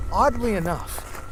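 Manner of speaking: plain reading voice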